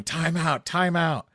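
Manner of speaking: high shouted call of time out; high-pitched mock yell from the bench; coach yelling. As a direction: bark it like a frantic coach. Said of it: "our coach is yelling".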